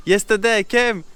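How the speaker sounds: loud, high, playful; loud playful call. Real, not acted. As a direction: call it out teasingly. Shopkeeper jokes, loud.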